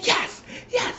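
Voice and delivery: very high whispered yes, yes; breathy mock yes. Tanya tells Eddie the man is bothering her, very high and whispered. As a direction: gasp it out high like a scared wife.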